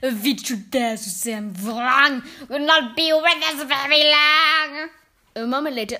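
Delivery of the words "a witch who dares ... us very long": loud witch chant slowing at the end; terrible recital. A narrator's reading. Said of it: "continued with a terrible recital".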